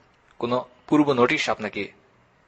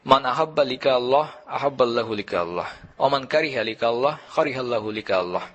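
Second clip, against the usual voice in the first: measured Arabic recitation in balanced phrases; Arabic hadith recitation. Acted. Preacher quoting the Prophet's words to listeners, recited in Arabic. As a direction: recite it evenly, each second phrase dropping slightly.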